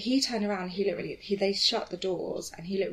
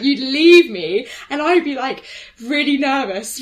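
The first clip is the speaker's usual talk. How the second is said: mock whine; 6 semitones higher, 12 dB louder than usual. Friend's mock complaint.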